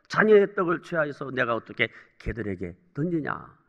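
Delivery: low, soft, coolly voiced refusal; cold reply. Jesus answering the pleading mother, coldly. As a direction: quote a detached rebuff quietly. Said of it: "주님은 너무너무 냉정하게 대저-, 대답하십니다".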